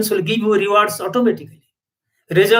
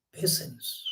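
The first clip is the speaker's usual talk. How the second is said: a hushed, reverent whisper; whispered one-word reminder; 15 dB quieter than usual. Real, not acted. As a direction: breathe the word "Patience". Single word after pause.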